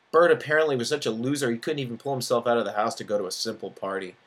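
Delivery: plain reading voice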